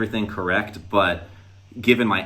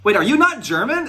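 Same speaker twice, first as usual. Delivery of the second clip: very high, louder double-take; astonished question; 14 semitones higher, 5 dB louder than usual. Retold or imitated. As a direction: ask it in rising disbelief. Retold astonished discovery, his own question.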